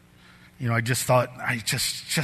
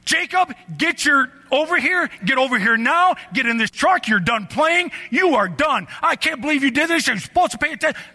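high, loud furious tirade; dad re-enacting a screaming rant at his son; yelling and screaming; 13 semitones higher, 5 dB louder than usual. Father screaming at his son to get in the truck. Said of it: "Yelling and screaming and yelling and screaming".

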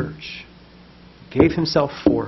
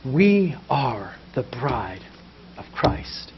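tender; 3 semitones lower, 6 dB quieter than usual. Real, not acted. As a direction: let it fall gently. Tender closing of sermon.

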